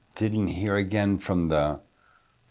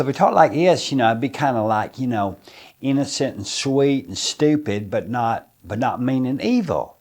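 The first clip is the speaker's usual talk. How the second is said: country bumpkin; 5 semitones higher than usual. Shown as a demonstration. Actor demos the sweet, dim Southern bumpkin voice. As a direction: play it innocent, sweet and a bit dim, with a Southern lilt. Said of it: "change the accent to a very light agrarian Southern accent".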